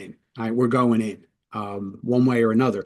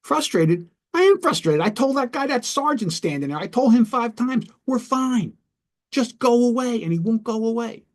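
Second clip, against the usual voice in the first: high, exasperated acted rant; frustrated; 11 semitones higher than usual. Man venting about the sergeant to the negotiator, frustrated. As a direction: echo the word indignantly, then vent in a high voice. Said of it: "you sound really frustrated".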